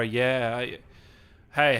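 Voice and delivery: ordinary talk, nothing marked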